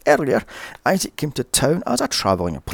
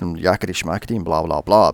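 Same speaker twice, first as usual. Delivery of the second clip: ordinary talk, nothing marked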